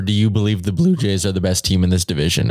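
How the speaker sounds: real voice crack mid-question. Real, not acted. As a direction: voice breaks on the question. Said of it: "As my voice cracks while I say that".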